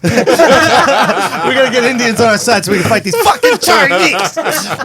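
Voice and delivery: laughing through the line; giggling mock battle cry. Laughing joke about fighting.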